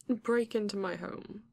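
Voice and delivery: ordinary talk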